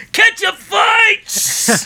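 high, drawn-out shout; loud, stretched-out mock battle cry. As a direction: yell it like a gleeful call to food war. Boss announces a prank attack.

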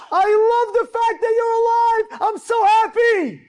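high, loud cry of relief; overjoyed. Retold or imitated. Father to the son he feared dead, overjoyed shouting. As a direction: proclaim joy at full voice.

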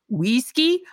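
high, rising "whiskey" as "weeskey"; Slavic accent. A narrator's reading. Old baker lists last ingredient, Slavic accent. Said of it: "The voice was heavily accented".

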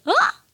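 a very high, joyful mock shriek; imitating a delighted little scream; happy scream imitation. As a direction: squeal it out like pure joy. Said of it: "like, really happy".

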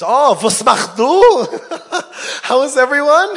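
high, loud, overly cheerful innocent voice; playing dumb. Man caught by mobsters plays dumb, falsely cheerful. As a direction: chirp it out like a clueless tourist.

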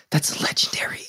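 whispered in hushed awe; awed whisper. Retold or imitated. Dad pointing out a legend to his son, whispering. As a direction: whisper it so the star won't hear.

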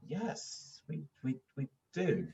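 hesitant, thrown-off retold response; taken aback. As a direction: act out a stunned, stammered answer. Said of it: "I was taken aback".

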